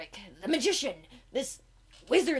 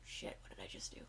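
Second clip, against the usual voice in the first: whisper; 16 dB quieter, faster than usual. Real, not acted. Whispered aside.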